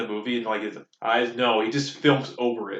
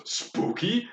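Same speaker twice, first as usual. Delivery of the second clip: high, eerie call of the creepy guy; Spooky Guy calling out from the dumpster, eerie; 8 semitones higher, 4 dB quieter than usual. Retold or imitated. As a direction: pop out of the dumpster and call it eerily. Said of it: "whenever he did his spooky voice".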